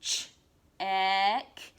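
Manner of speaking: teacher segmenting check slowly; word stretched into sounds. Shown as a demonstration. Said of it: "said it really slowly".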